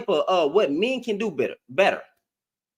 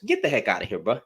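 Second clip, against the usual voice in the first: ordinary talk, nothing marked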